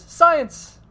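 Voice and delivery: high, loud exclamation; Chris Hardwick. Retold or imitated. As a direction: belt it out like a show host. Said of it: "what he says afterwards is".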